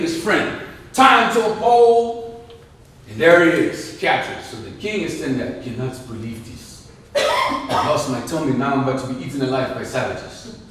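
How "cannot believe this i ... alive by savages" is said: put-on African accent. Captured king laments.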